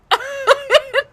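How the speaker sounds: high-pitched giggle; high laugh. Real, not acted. Storyteller recalling the nose prank gone wrong, high laugh.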